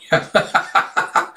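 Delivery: quick bubbling chuckle; short run of giggles; amused laugh. Real, not acted. Vlogger amused while retelling the fight, chuckling.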